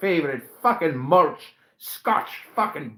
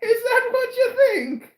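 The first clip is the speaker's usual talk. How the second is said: high squeaky leprechaun voice, higher. Acted. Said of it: "He does sound like a leprechaun".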